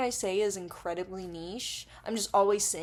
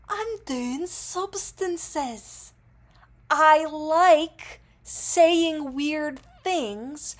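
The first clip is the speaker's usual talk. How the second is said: high, very drawn-out declaration; mock confession; 7 semitones higher, 7 dB louder, much slower than usual. YouTuber jokes about being under the influence, drawn-out mock confession. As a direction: stretch each word out absurdly, pitched up.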